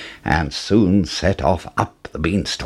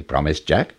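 usual speaking voice

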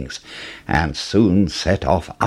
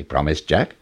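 ordinary delivery

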